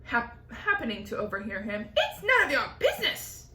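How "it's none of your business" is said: high, loud snap; snapping aunt. Acted.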